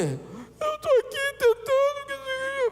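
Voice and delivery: very high, slow, whiny sobbing voice; mock crying daughter. Daughter crying to her father, high mock sob. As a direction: wail it like a weeping girl.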